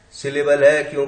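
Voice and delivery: ordinary talk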